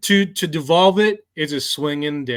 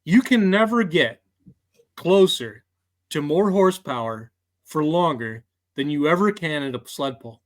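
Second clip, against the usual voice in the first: ordinary talk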